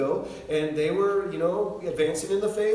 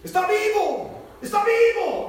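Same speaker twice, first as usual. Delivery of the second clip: high, loud imitated outburst; yelling; 6 dB louder than usual. Preacher yelling to the market crowd, high and loud. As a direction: yell it out like a public alarm. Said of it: "he starts to yell".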